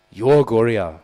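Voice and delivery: loud scolding repeat; loud repeated rebuke; upset outburst. Retold or imitated. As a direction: hammer it out, displeased. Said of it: "becomes very upset".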